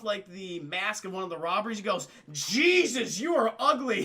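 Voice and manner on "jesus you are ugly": loud, punched-out disbelief; mock appalled insult. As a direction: recoil in comic horror, on the edge of laughter. Acting Spider-Man's insult at robber.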